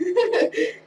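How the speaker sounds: loud, high burst of laughter. Real, not acted. Laughs at own story.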